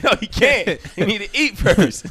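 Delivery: high, loud laughing no; laughing refusal. Retold or imitated. Dad insisting son eats first, laughing loudly. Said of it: "I said".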